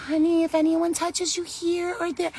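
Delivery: high-pitched mock baby-talk; talking to little babies. Parent warning a very young child, babying. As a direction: imitate a parent gently instructing a toddler. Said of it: "the talk we have with the little babies".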